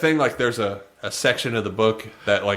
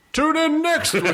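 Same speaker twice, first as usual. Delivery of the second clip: mock announcer; 7 semitones higher, faster than usual. Mock show sign-off announcement.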